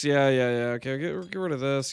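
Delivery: normal talking voice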